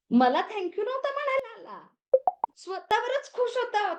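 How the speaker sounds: excited. Real, not acted. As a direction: gush about how pleased he was. Teacher delighted retelling client's pride.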